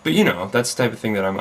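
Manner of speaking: ordinary talk, nothing marked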